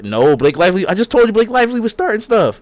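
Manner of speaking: high, loud insistent rebuttal; exasperated insistence. Real, not acted. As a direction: push back hard, as if not being listened to. Argues back, repeating what was told.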